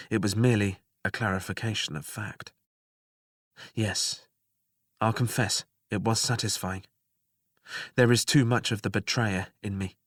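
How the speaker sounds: usual speaking voice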